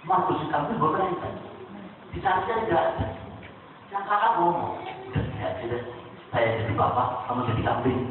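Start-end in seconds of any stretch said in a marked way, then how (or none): 5.1-8.0 low voiced older brother.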